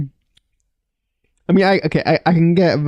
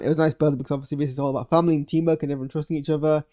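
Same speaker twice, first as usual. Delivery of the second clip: ordinary talk, nothing marked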